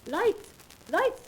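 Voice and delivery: loud, high alarmed call; shouting for lights. Acted. Medium calls out to her helpers as the seance is disrupted, alarmed shout. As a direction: yell for the lights like the show is collapsing.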